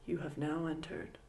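ominous TV host intro, kept low; Twilight Zone narrator. Retold or imitated. Friend quotes the eerie TV host, in a low hushed narrator voice.

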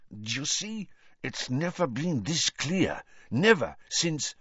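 urgent through barely moving lips; agitated. Acted. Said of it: "not to move his lips".

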